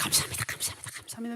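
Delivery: breathless whispered thank-yous to God; hushed grateful whisper. Retold or imitated. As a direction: whisper the thanks under your breath, overjoyed. Pastor quietly thanking God.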